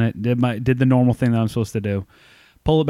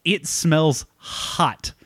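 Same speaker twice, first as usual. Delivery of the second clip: slow, drawn-out deadpan verdict, pitched up; deadpan salesman; 4 semitones higher, much slower than usual. Retold or imitated. Salesman telling the driver the car smells, slow deadpan. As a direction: drag each word out like grim news. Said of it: "He's like".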